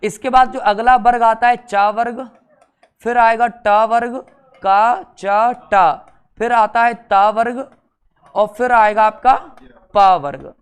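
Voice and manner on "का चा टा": slow, loud, each syllable spaced; slow syllable drill. Shown as a demonstration. Teacher drilling a class in slow loud syllables. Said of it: "इनको एक sequence में याद रखो".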